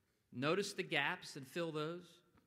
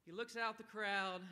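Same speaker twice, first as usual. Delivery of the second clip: ordinary talk, nothing marked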